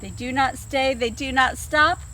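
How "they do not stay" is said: ordinary delivery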